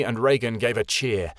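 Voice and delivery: usual speaking voice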